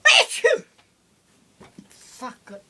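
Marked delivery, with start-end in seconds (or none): 0.1-0.6 loud sudden sneeze; a big real sneeze; sneezing. Real, not acted. Sneezing fit.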